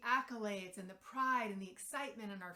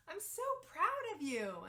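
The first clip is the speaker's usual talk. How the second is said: put-on high, louder little-girl praise; proud toddler voice; 8 semitones higher, 6 dB louder than usual. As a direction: beam it like a tiny kid proud of herself. Toddler praising herself on potty.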